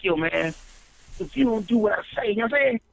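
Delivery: a hammed-up LA gangster listing threats; mock LA gangster. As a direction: rattle off threats like a hammed-up LA gang member. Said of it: "the gang members that used to be threatening".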